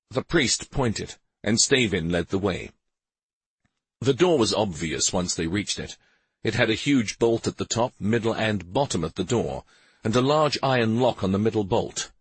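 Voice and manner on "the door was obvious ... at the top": ordinary delivery, nothing marked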